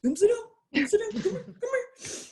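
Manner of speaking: put-on squeaky excited old trainer calling people over; George's voice. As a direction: wave them over in a high sing-song. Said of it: "He talks like that too".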